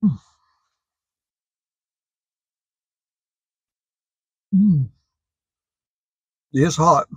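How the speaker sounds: talking between bites of burger; chewing while talking. Real, not acted. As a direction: mouth partly full of food. Seen 1: Bitten burger held low; lips pursed mid-chew; hand not at mouth.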